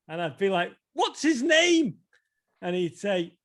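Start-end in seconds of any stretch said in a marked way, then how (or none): 0.9-2.0 high, drawn-out mock kid question; child's eager question. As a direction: act out a kid asking, pitched way up. Acting his younger self asking grandpa.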